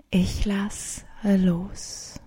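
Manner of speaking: slow, soft whisper on the exhale. Shown as a demonstration. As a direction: let the words fall out slowly, whispered. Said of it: "mit der Ausatmung denken".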